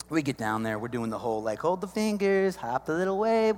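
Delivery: normal talking voice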